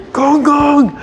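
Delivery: put-on high, loud child's call. Toddler calling grandpa who runs off, high and loud.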